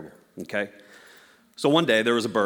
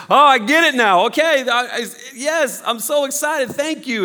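much higher, louder gushing joy; hammed-up elated bird, fading in volume; overjoyed bird; 12 semitones higher, 6 dB louder than usual. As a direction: squeal your thanks. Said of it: "the bird is like".

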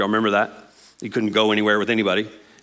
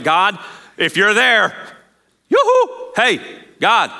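high, drawn-out hail, soaring on yoo-hoo; mock hailing someone who may not be there; calling out; 8 semitones higher, 4 dB louder, slower than usual. Praying person tries to get God's attention, calling out. Acting out calling to absent God.

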